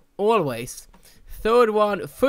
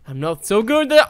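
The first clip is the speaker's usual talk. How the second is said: usual speaking voice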